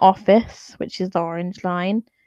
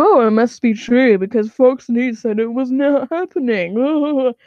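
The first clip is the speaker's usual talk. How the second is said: put-on dim denier voice; mock a Fox News believer; mocking Fox viewer; 3 semitones higher than usual.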